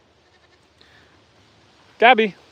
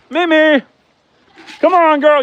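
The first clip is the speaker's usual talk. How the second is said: high, louder coaxing call; calling the goat; 7 semitones higher, 4 dB louder than usual. Real, not acted. Farmer coaxing the goat Mimi back in, high and louder. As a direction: call her in sweetly but loud.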